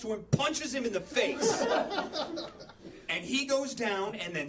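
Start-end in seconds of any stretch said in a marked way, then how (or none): 1.7-3.0 silent wheeze of laughter way up high; high breathless laugh. Real, not acted. Laughing at story.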